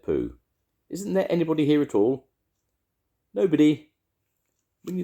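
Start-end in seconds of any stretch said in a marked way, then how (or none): 0.9-2.3 high, loud questioning call. A narrator's reading. Pooh calls into hole again. 3.3-3.9 high, loud single word; called-back denial. A narrator's reading. Rabbit calls back from hole.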